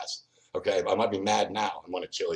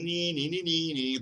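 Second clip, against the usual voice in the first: put-on high childish "nee nee" tease; mocking taunt; 9 semitones higher, slower than usual. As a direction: chant it up high like a schoolyard taunt. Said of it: "mocking us".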